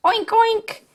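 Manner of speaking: high, squeaky little oinks; piggy oink. Pretend. Runaway toy pig taunting, high squeaky oinks. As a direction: oink like a cartoon piglet.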